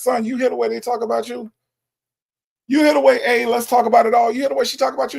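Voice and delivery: high-pitched, disbelieving scolding; high incredulous questioning. Real, not acted. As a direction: pitch it way up, astonished at them. Host mock-scolds, high incredulous voice.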